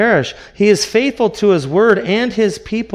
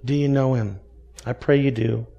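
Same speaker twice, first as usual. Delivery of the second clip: ordinary delivery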